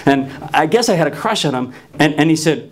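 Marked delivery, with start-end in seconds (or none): none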